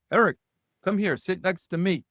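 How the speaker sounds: a hint of a Filipino father's accent; light Filipino accent. Father beckons his guest to a seat, light Filipino accent. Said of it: "I don't do a good accent".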